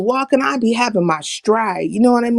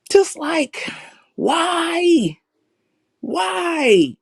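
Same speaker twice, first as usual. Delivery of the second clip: higher, drawn-out pleading why; exasperated why; 7 semitones higher, much slower than usual. Retold or imitated. Fed up with people darting around the airport, pleading why. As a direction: throw up your hands and ask why.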